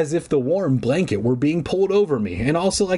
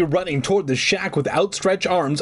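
plain reading voice

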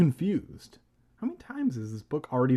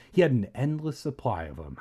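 usual speaking voice

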